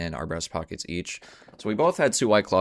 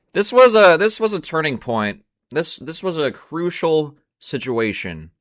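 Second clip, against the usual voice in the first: louder and higher, announcing a big moment; dramatic buildup; 8 semitones higher, 8 dB louder than usual. Real, not acted. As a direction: hype up the turning point.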